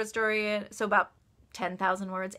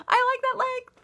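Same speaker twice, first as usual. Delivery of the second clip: much higher, playful protest; mock protesting reply; 4 dB louder than usual. Author answering readers about novella length, high-pitched mock protest. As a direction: push it up high in mock defence.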